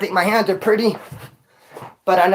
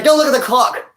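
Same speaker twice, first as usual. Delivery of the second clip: coach shout; 6 semitones higher, 3 dB louder than usual. Retold or imitated. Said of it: "he's like".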